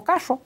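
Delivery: put-on Carro said high like a little boy, with the Correntino R; child saying Carro. Young son answering the speech therapist, high childlike Carro. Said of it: "el chico dijo".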